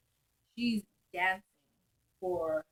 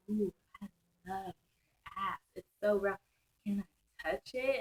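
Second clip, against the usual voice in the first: quick, soft come-on; flirty stripper voice; 3 semitones lower, 9 dB quieter, faster than usual. Retold or imitated. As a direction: purr it fast and hushed. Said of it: "She's like".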